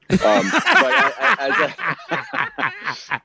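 words breaking up in high laughter; real laugh bubbling through slow words. Real, not acted. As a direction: caught laughing mid-sentence. Laughs at own admission.

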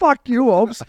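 high-pitched replay of a heated comeback; mock angry retort. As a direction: swear at a friend in mock indignation. Replays the retold phone quarrel.

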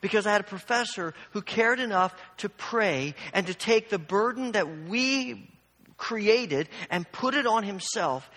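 normal talking voice

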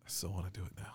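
whispered confession. Real, not acted. Admitting to Cody he still wants to, whispered. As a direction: whisper it like a guilty secret.

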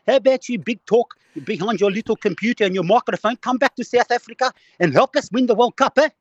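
high-pitched, hammed-up South African accent; Jacques Kallis. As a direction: rant in a loud mock South African voice. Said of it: "It, it's Jacques Collis here".

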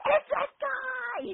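very high, slow, laughing cry; revealing the patient to New Jack, laughing shout. Retold or imitated. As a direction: shriek it out through laughter, drawn out. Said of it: "I went".

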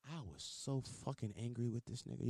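ordinary delivery

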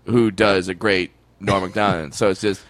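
ordinary talk, nothing marked

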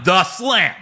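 Michael Cole. Retold or imitated. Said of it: "as Michael Cole called them".

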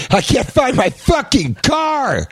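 drawn-out, high-pitched mock shout; comic meltdown, slow and very high; angry caricature artist. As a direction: wail it in hammed-up rage. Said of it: "Here's the angry caricature artist".

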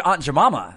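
"Jemima" said as juh-MAH-muh; "Jemima" rhyming with "mama"; mispronounced Jemima. Real, not acted. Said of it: "did you just say Aunt Jemima".

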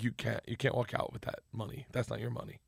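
low, hushed imitation of a mobster; quiet gangster warning. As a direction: lay down the rule softly. Igor's quiet warning, retold.

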